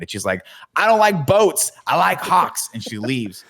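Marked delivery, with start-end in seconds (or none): 0.8-2.7 mock teenage defiance, higher and louder; hammed-up stubborn comeback, pitched high; defiant retort. Julie snubbing Miyagi's wisdom before she leaves, mock defiant.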